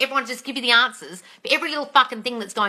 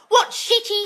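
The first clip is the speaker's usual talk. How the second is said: ordinary delivery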